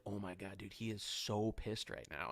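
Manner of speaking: low, soft confiding to a friend; hushed aside. Retold or imitated. Friend to storyteller about the angry star beside them, hushed aside. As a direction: drop it low and under the breath.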